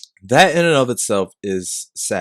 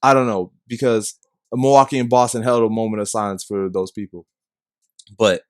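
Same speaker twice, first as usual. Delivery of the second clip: usual speaking voice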